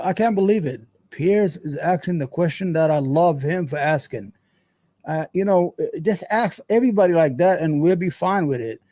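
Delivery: ordinary delivery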